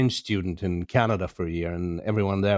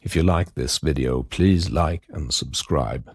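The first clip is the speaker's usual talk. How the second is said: lower, measured outro announcer read; podcast outro read; 7 semitones lower than usual. Acted. Host signing off to listeners, announcer read. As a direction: sign off the episode like a host.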